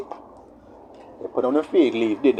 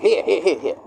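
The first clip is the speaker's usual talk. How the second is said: quick, higher-pitched repeated offer; hurried parent; 4 semitones higher than usual. Acted. Parent answering a hungry child, hurried voice. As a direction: hand it over to shut them up. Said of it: "You're like".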